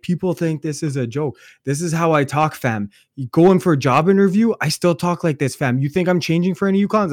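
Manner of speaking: put-on Toronto accent. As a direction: imitate an indignant Toronto accent guy. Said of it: "a guy had the accent".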